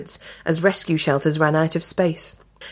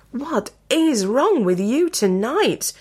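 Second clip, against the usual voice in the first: wife's sharp, higher, slow demand; 6 semitones higher, slower than usual. A narrator's reading. Woken wife demanding answers from husband, exasperated. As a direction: press him for an answer, exasperated. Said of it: "his wife demanded".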